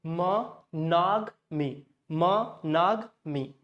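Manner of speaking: monogamy slowly broken, muh... NOG... mee; slow drill of "muh-NOG-mee" for monogamy; syllable-by-syllable MONOGAMY. Shown as a demonstration. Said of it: "That's how it is pronounced".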